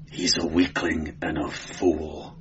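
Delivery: low, slow, quiet growl; growled contempt. A narrator's reading. Cimmerian dismissing the moaning clerk, low growl. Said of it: "he growled".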